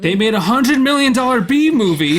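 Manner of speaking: loud, high, thrilled insistence; excited exclamation. Real, not acted. Film fan correcting cohost's doubt, excited exclamation.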